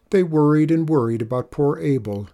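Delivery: ordinary delivery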